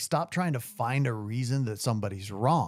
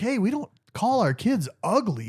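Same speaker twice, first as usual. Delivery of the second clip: indignant retort; 8 semitones higher, 4 dB louder than usual. Retold or imitated. His indignant retort retold.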